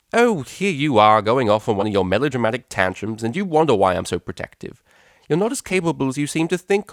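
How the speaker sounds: mother scolding Octavia for overreacting; scornful mother. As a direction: belittle her as a tantrum.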